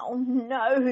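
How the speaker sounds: fed-up papa bear; Mister Bear fed up. A narrator's reading. Mister Bear kept awake, groaning in exasperation. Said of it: "said Mister Bear".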